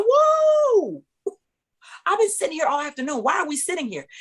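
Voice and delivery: high, loud whoa into a complaint; indignant protest. Retold or imitated. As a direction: reenact an outraged objection, starting way up high. Retold protest at being dismissed.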